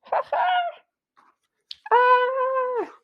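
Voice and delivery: high nervous laugh then a cry; startled girl yelp. Acted. Girl yelps in fright at the witch.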